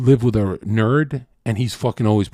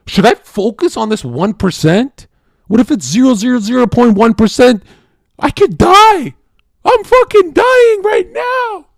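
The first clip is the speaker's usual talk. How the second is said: loud, high-pitched mock hysteria; hammed-up panic; 12 semitones higher, 9 dB louder than usual. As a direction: spiral upward into a screaming imitation of panic. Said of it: "the chaos in their brain goes".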